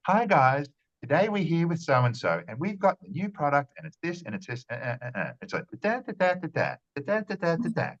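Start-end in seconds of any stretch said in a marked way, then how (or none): 0.1-5.3 high sing-song influencer voice, much higher; mock a chirpy product presenter, pitched up; fake presenter sing-song. Mocking a camera presenter selling a product, in high sing-song. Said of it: "it's that kind of tone". 5.8-7.9 high sing-song nonsense syllables, much higher; musical note tone. Shown as a demonstration. Showing the fake presenter melody on nonsense syllables, high sing-song. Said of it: "it's kind of a musical note".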